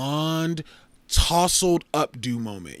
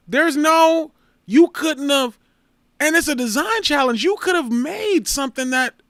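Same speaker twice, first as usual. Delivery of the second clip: higher and louder, indignant; higher, louder, indignant critique; worked-up rant; 14 semitones higher, 7 dB louder than usual. Real, not acted. Ranting at the unfinished design.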